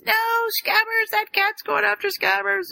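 high panicked cry; freaking out. Retold or imitated. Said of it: "Ron freaks out".

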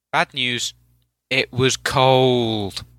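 drawn-out dry punchline; slow and dry; slow deadpan. Real, not acted.

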